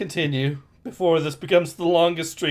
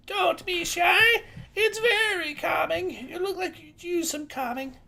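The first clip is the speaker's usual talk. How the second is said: high, sweet granny voice; old lady voice; 11 semitones higher, 5 dB quieter than usual. Acted. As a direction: coax like a kindly old woman. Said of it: "All right, I'll voice her".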